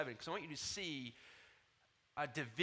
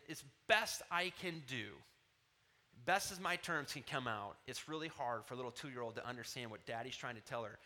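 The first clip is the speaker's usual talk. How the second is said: ordinary delivery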